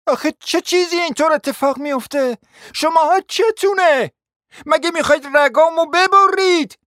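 high, loud, slowed outraged shout; furious shout. Acted. Hostess screaming at the guests in fury.

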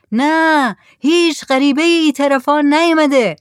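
high, loud shout back to the boat; shouted reply. A narrator's reading. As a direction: holler the answer across the water. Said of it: "صالح داد زد". (با داد جواب دادن)

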